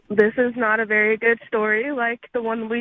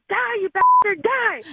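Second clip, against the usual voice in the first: higher, louder mock battle cry; furious; 8 semitones higher, 4 dB louder than usual. Mom yelling at bug with broom, mock furious. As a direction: swing the broom and yell. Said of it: "so I go".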